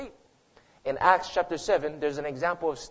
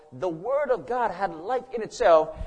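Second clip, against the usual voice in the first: ordinary talk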